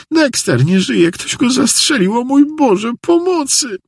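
shrieking high, distraught wife; hysterical cry for help. A narrator's reading. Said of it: "zawołała na jego widok Jackie". (histeryczne wołanie o pomoc)

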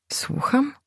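thin, high, breathless whisper; a reedy little whisper of disbelief. A narrator's reading. Confused husband answers Gośka in a thin whisper. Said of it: "spytał cienkim głosem".

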